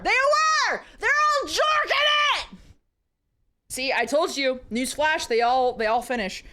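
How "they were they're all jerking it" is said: high, loud excited squeal; excited shriek. Real, not acted. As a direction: blurt it out thrilled and shocked. Excited outburst to chat.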